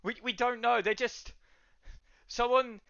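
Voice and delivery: high, loud, urgent warning; alarmed barwoman. Acted. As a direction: cry out the news in a high voice.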